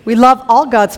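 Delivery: loud, laughing take-back. Real, not acted. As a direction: laugh it off and make peace. Laughing backpedal after chant.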